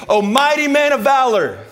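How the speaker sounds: high, loud heroic hail with a long held note; God's proclamation. Acted. God hailing the hiding Gideon, proclaiming. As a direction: call it out grandly like a herald. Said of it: "You know what God tells".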